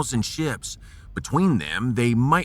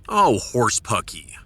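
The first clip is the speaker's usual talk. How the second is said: ordinary talk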